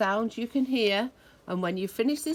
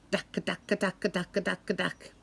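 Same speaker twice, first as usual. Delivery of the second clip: jackhammer; 2 semitones lower than usual. Retold or imitated. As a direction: hammer each dack evenly. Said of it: "loud banging sound".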